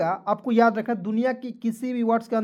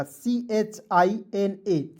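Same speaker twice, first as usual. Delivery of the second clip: spelling out slowly; much slower than usual. Shown as a demonstration. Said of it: "China".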